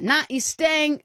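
slow high mocking refusal; high drawled kid taunt; rude kid. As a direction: sneer it like a cheeky kid. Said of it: "He's like".

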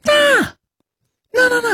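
high squeaky falsetto no, no, no; teasing falsetto refusal; mock falsetto denial.

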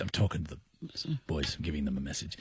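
softly, not into the recording; hushed aside. Pretend.

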